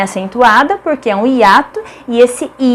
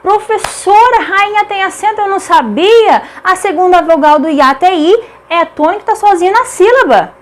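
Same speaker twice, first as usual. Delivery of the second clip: high-pitched mock student voice; imitated student; 7 semitones higher than usual. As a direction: play a pupil objecting to the teacher, pitched high. Voices an objecting student to herself. (imitação de aluno, com voz bem mais aguda)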